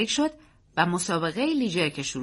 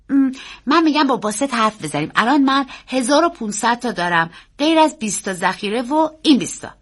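put-on high, loud child's voice; raised-pitch young nephew, businesslike; earnest boy; 5 semitones higher, 6 dB louder than usual. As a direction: lay out the plan as an eager boy. Boy's voice in story.

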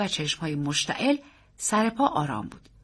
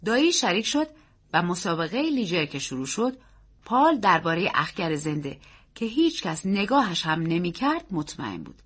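plain reading voice

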